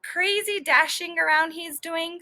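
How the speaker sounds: a teacher calling the class's attention; scolding teacher. Acted. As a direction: point it out to the whole class. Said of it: "said the teacher".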